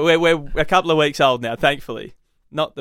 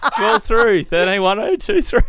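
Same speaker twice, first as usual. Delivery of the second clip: laughing plug; 6 semitones higher than usual. Real, not acted. Laughing phone number plug.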